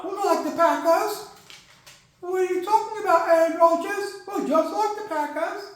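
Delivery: high-pitched put-on protest; high voice of comic bafflement; baffled retort. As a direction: answer back in a high, incredulous voice. Acts out baffled team replying.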